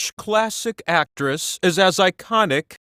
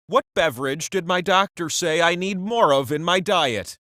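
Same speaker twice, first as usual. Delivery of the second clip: ordinary talk, nothing marked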